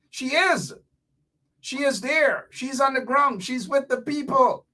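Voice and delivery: high-pitched, insistent hype; emphatic praise. Real, not acted. Hyping the surprise guest.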